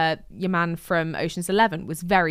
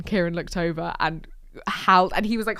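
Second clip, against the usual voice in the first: ordinary talk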